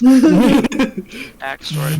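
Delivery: drawn-out laughing word. Real, not acted. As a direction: stretch it out while laughing. Mocking repeat of backstory.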